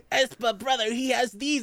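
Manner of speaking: lightly voiced tattling sibling; mock twin voice. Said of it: "they're just like".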